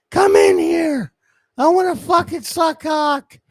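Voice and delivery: high, loud, shrill woman's yell; mock drunk woman calling out. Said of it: "That's what she was yelling".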